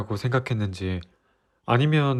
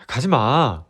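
urgent plea; 3 dB louder, much slower than usual. Acted. Begging her not to leave.

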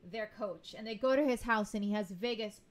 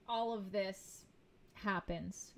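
ordinary delivery

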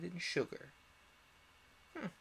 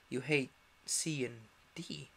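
ordinary delivery, nothing marked

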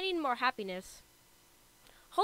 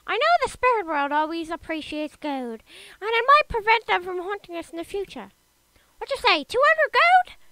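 loud, high witch voice with a cackle; 7 semitones higher, 10 dB louder than usual. Acted. Witch asking the ruler for gold for the spirits, loud high witch voice. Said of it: "Oh, it's the witch".

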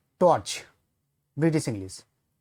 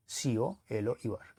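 very slow, softer letter-by-letter সিওএলওইউআর; teacher slowly spelling the word again, more quietly; 3 semitones lower, 7 dB quieter, much slower than usual. Shown as a demonstration. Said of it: "এই ইউ American ইংলিশে বলছে".

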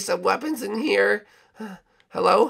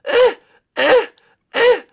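ordinary delivery

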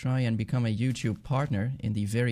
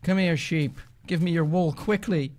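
high, urgent calls; excited chase-it-down commands; calling out; 7 semitones higher, 3 dB louder than usual. Real, not acted. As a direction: call after a runaway animal, pitched up. Calling after fleeing sheep.

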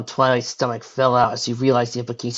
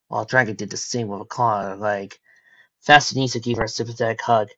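usual speaking voice

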